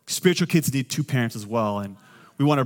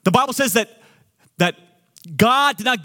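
preaching at full force; 6 semitones higher than usual. Acted. Pastor rallying the congregation against fear, preaching at full force. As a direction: build it like a pulpit climax.

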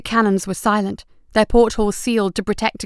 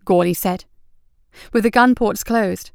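plain reading voice

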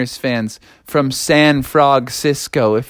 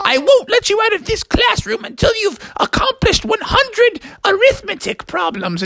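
strict teacher. Acted. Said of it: "And she said".

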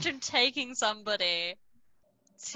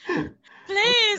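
high, loud begging; hammed-up desperate beg; mock desperate plea; 11 semitones higher, 7 dB louder than usual. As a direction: plead loudly, half laughing.

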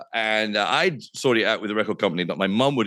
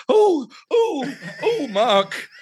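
high, louder flustered gasps of dismay; shocked presenter; 3 dB louder, much slower than usual. Retold or imitated. Sam Hayward hearing him swear live, shocked and flustered. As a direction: play the horrified studio host. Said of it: "who was like".

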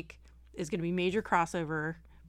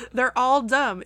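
loud, raised-pitch rant through laughter; laughing; 5 semitones higher, 9 dB louder, slower than usual. Real, not acted. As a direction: declare it while cracking up. Mother ranting while laughing.

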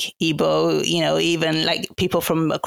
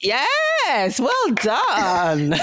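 high, drawn-out cheer breaking into laughter; delighted praise; 5 semitones higher, much slower than usual. Real, not acted. Guest praising host's attempt at the name, delighted cheer. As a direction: cheer them on for getting it right.

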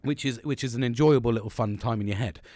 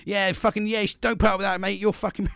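higher, louder matey outrage; mock-indignant best mate taking his side; mate cheering him on; 8 semitones higher, 4 dB louder than usual. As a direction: egg him on like a loyal mate. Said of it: "the Nelster straight away actually goes".

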